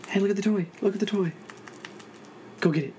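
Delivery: high coaxing voice for the cat; pet talk. Real, not acted. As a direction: lure the cat to the toy. Tempting cat with toy.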